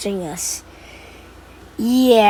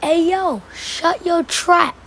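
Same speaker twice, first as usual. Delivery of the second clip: high-pitched, drawn-out telling-off; raised-voice imitation of scolding; retold yell; 8 semitones higher, 3 dB louder, much slower than usual. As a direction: yell it at him, stretching the last words. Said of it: "So I yell at him".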